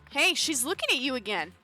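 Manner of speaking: usual speaking voice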